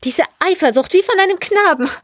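high-pitched scornful rebuke; contemptuous. A narrator's reading. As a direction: scold him with open disdain. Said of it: "Verächtlich schüttelte sie ihren Kopf".

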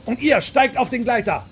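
very high, loud screamed order; furious scream. A narrator's reading. Willis ordering the Odai aboard, screaming. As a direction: scream the command. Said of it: "schrie Willis".

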